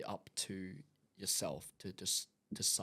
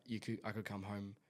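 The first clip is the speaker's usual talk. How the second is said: ordinary delivery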